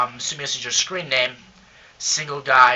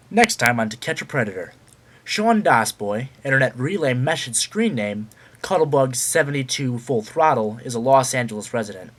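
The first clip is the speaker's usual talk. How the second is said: dramatic TV crime teaser; news announcer; 5 dB louder than usual. Acted. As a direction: read it like a Dateline promo. Mock Dateline news teaser.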